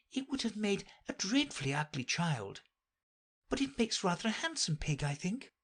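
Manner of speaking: put-on high, soft storybook girl voice; puzzled young Alice. A narrator's reading. Alice asks herself what to do with the creature, puzzled. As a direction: wonder aloud in a high, soft voice.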